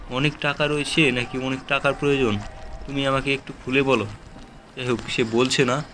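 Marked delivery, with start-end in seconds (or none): none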